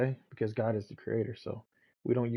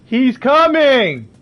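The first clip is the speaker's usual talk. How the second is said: drawn-out, much higher and louder yell; shouted call; 11 semitones higher, 15 dB louder, much slower than usual. Real, not acted. Gamer yelling to the house that someone is coming, high loud shout. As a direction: belt it across the house, slowly.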